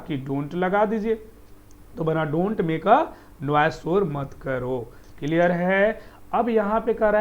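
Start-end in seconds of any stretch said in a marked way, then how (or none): none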